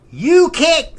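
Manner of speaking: very high, loud imitation; scolding lady. Bowling alley lady banning a customer, high loud imitation.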